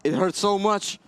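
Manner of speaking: rising excited shouting. Real, not acted. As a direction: yell it as the tension climbs. Tense final fight, caster yelling.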